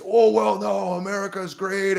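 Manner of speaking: high, mock-indignant protest; mock patriots insisting America's great, high and indignant; mocking everybody.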